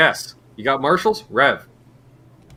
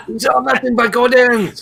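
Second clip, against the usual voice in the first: high mock French Canadian clerk; French Canadian accent; 12 semitones higher, 4 dB louder than usual. Mocking a Canadian store clerk, French Canadian accent. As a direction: play the Quebec store clerk, hammed up. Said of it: "broken French Canadian accents".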